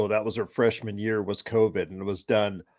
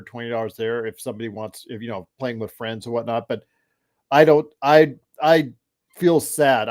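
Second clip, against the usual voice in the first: usual speaking voice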